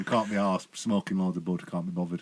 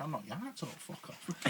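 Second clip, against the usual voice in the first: seething thought; 3 semitones higher, 16 dB quieter than usual. Retold or imitated. Fuming, private thought.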